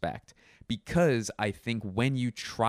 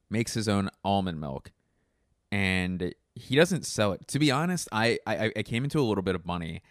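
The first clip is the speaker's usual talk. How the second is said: normal talking voice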